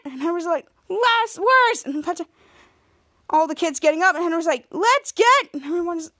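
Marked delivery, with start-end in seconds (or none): 0.9-1.8 high-pitched chanted reply; louder team response. Retold or imitated. Kids answering Henry's call, louder chant. 4.7-5.5 high, loud chant lead; rallying call. Retold or imitated. Henry leading the kids' chant, rallying call. Coach leads a team chant.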